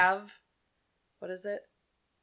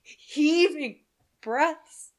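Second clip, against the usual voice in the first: rising higher and louder into breathy passion; higher, louder melodramatic Kahn; Madeline Kahn impression; 4 semitones higher, 6 dB louder, slower than usual. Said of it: "I like Madeline Kahn".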